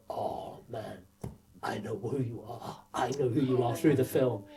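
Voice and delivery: softly voiced praise from a director.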